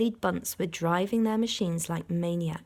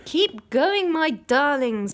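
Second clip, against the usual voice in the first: breathless, higher and louder; panting; 8 semitones higher, 7 dB louder, slower than usual. A narrator's reading. Mister Fox panting encouragement to his children. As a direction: push on while out of breath. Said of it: "panted Mister Fox".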